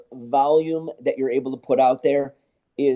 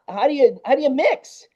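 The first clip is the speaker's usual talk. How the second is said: high, stammered, incredulous asking; retold baffled question; 11 semitones higher than usual. Visiting engineer asks how anyone mixes there, baffled. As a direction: restart it, pitching up in bewilderment.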